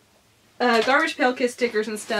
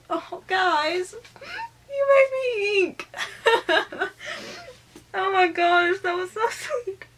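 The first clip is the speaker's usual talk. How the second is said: high, happy crying with laughs breaking in; real tearful laughter, overwhelmed by kindness; emotional, tearful; 8 semitones higher, slower than usual. Real, not acted. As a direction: choke up and squeal through happy tears. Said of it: "Just ignore me being an emotional fluff ball".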